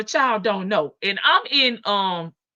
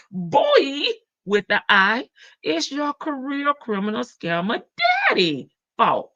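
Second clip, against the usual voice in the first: ordinary delivery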